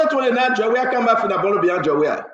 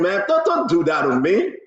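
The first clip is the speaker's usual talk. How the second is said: ordinary delivery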